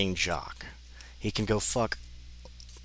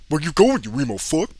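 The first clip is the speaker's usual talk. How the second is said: loud high jeering taunt; tough bully; 10 semitones higher, 7 dB louder than usual. Acted. Knuckles taunting the emo kid, loud jeer. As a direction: jeer at him like a thug.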